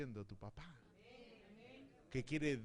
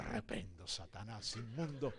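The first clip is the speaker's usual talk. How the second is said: mock rebuke; 6 semitones lower than usual. Rebuking the devil in retold scene.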